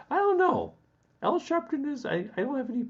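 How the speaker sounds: high squeaky fluster; flustered squeak. Real, not acted. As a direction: squeak out the words, caught off guard. Sudden squeaky flustered reaction.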